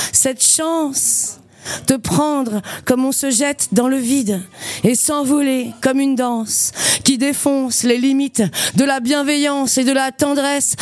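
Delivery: intense spoken-word performance; slam poetry. Acted. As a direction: recite it like a slam poem on stage. Performs her slam poem.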